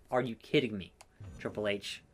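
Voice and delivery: soft, low grumble of disbelief; muttered disbelief. Real, not acted. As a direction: complain under your breath. Muttered complaint at game boss.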